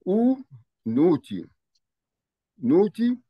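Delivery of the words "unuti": unuti drawn out very slowly; slow pronunciation. Shown as a demonstration. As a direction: spell out unuti syllable by syllable. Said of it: "the next word is".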